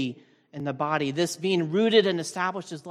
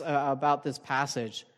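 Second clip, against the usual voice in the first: ordinary talk, nothing marked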